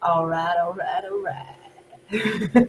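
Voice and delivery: low, very slow drawled catchphrase; Matthew McConaughey. Acted. Showing friends an impression, slow Matthew McConaughey drawl. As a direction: stretch it out low and lazy. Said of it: "That's an impression I can do".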